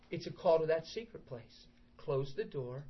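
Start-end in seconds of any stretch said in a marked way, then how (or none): none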